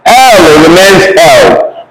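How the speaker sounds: ordinary delivery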